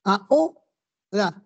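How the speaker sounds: very slow "Ahora", broken into a, o, ra; syllables pronounced apart. Shown as a demonstration. Teacher showing it is no diphthong, "Ahora" split slowly a-o-ra. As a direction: separate each vowel of "Ahora" with pauses. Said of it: "ya está separado".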